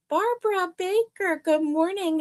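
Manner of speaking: lifted high, sing-song hello; bright high greeting. Real, not acted. Host greeting a viewer by name, high and cheery.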